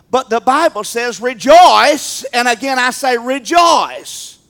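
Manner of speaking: slow, loud proclaiming; full-force preacher proclaiming rejoice; preaching. Acted. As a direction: preach it out, stretch each word.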